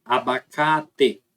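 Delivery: abacate said slowly, every A open and oral; slow example word. Shown as a demonstration. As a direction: spell out abacate syllable by syllable, oral A. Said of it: "quando a gente pega um som A assim".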